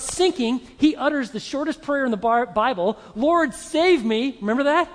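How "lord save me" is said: loud, high, slow plea; cry for help. Acted. Peter sinking into the lake, desperate cry. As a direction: call out urgently for rescue.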